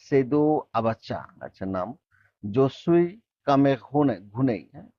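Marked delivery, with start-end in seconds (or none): 0.1-1.2 slow, careful name for learners; slow demo. Shown as a demonstration. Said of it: "teacher models French line". 2.6-4.2 slow, careful French for learners; slow demo. Shown as a demonstration. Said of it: "teacher models French line".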